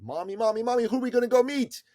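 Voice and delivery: put-on high, loud, fast child voice; playful imitation of a small boy calling his mom; excited little boy. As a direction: bounce it like an eager kid.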